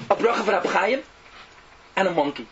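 normal talking voice

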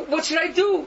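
loud, high cry for help; desperate plea. Retold or imitated. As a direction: plead desperately with the rebbe. Sinner pleads under the rebuke.

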